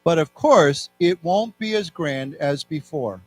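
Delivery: slow, evenly spaced phrases with long pauses; read-along. Shown as a demonstration. Teacher leading students through a text, slow read-along. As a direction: read slowly, leaving room for repeats. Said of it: "So let's read this together".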